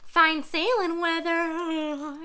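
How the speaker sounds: high, slow yawn-through line; yawning parrot. Acted. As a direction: stretch it out sleepily. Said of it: "yawned the bird".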